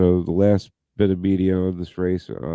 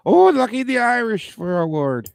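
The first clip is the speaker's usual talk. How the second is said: much higher, louder hammed-up Irish accent; playful Irish lilt, pitched way up; mock Irish accent; 5 dB louder than usual. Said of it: "In his O'Ward, O'Ward accent".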